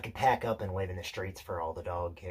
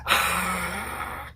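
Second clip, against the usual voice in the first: loud, high fang-baring snarl; imitated dog snarl; 9 semitones higher, 8 dB louder than usual. Showing Peter how the dog bares its fangs, loud snarl. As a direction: snarl suddenly like an attacking dog. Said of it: "when he bares his fangs like this".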